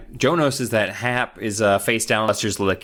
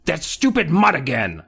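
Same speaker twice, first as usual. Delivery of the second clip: higher, gruff neighbor yell; shouted; 6 semitones higher than usual. Acted. Angry neighbor yelling at the dog from his door. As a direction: bark it at the mutt. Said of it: "he shouted".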